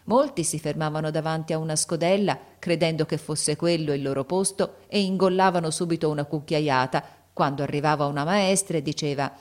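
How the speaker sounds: ordinary delivery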